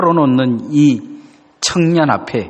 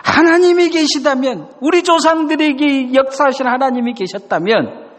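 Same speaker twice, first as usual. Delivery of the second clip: bursting complaint; 10 semitones higher than usual. Retold or imitated. Gideon venting his pent-up grievance at God, high and bitter. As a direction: hurl the doubt up at heaven. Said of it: "그런 이야기를 해요".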